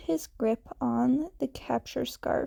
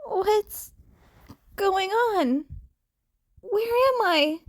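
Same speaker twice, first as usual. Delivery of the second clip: put-on confused hurt kid; 8 semitones higher than usual. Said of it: "they asked quietly and breathlessly".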